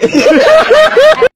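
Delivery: high loud laugh breaking the take; burst of laughter. Real, not acted. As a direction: cracks up laughing. (tertawa lepas)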